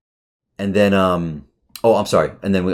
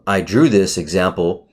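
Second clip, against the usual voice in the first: ordinary talk, nothing marked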